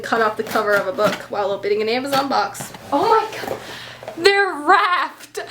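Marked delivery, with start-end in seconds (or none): none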